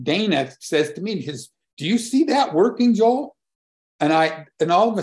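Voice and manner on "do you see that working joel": much higher, louder, eager ask; light up as if the idea just clicked; eager question. Retold or imitated. Neighbor eagerly asking Joel about the plan, high and keen.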